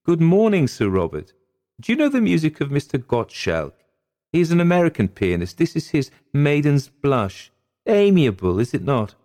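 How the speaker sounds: high, lilting lady's voice; genteel lady greeting. A narrator's reading. Maud to Sir Robert over her music, high animated greeting.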